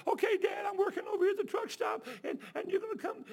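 high, loud eager shout; excited son. Retold or imitated. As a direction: blurt it out happily.

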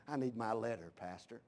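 low, hushed church-member plea; mock meek request. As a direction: murmur it low, trailing off to almost nothing. Mimics member quietly asking to leave.

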